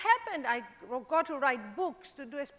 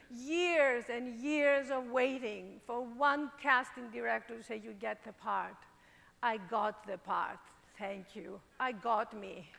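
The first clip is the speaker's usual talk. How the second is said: soft and moved; 6 semitones lower, 4 dB quieter than usual. Real, not acted. Moved realization, soft low voice.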